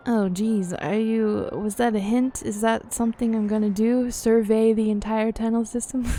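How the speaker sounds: ordinary delivery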